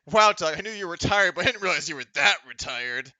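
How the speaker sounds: loud, taunting Ike voice; mocking jab. Ike mocking Doug at the table, loud and taunting. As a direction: sneer it loudly like a smug rival. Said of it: "He's like".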